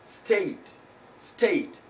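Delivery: "state" with a drawn-out gliding ay; diphthong ay. Shown as a demonstration. Teacher repeating the correct word, diphthong ay. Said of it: "not state".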